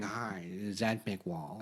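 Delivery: low, slow put-on caller voice; prank caller voice. Prankster calling the writer, low put-on voice. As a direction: fake a smooth phone caller. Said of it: "it's this voice going".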